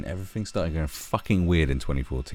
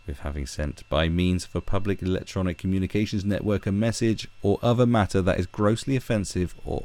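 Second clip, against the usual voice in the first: normal talking voice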